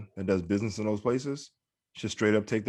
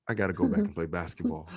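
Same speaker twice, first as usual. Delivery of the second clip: inner thought; 4 semitones lower than usual. Retold or imitated. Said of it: "I was thinking".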